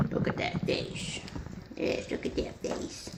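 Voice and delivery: soft whispered coo to the puppy; hushed, tender puppy talk. Real, not acted. Cooing softly to a puppy.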